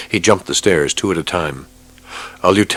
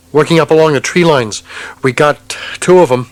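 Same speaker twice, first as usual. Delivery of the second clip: much higher tense report; tense lieutenant; 10 semitones higher, 5 dB louder than usual. Acted. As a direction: warn of the tanks.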